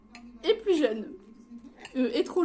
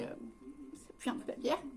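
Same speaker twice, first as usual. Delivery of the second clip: whispering; 12 dB quieter, slower than usual. Real, not acted. Whispered aside about beer smell.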